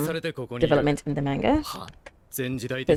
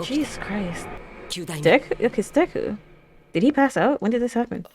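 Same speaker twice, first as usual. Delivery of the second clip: normal talking voice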